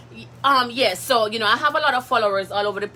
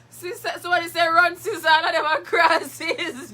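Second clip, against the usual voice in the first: higher, slow, giggling through the words; laughing; 7 semitones higher than usual. Real, not acted. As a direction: read it again while cracking up. Rereads comment while laughing.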